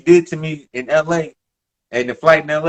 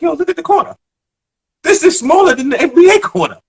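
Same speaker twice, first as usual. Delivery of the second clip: indignant protest; 14 semitones higher, 5 dB louder than usual. Retold or imitated. Arguing back at coach.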